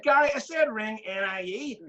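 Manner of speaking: high, slow mock-exasperated correction. Manager correcting his player's mishearing, slow exasperated retelling.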